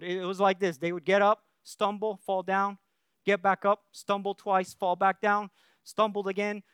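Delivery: ordinary talk, nothing marked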